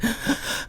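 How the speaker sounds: sharp high gasp; sobbing gasp. Retold or imitated. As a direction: gasp in through tears. Crying woman gasping.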